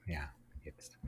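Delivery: breathy whisper. Real, not acted. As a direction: whisper it under your breath. Whispered aside.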